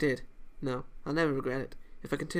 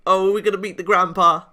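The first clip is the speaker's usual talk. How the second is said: excited exclamation; 5 semitones higher, 11 dB louder than usual. Real, not acted. Player excited at new scene.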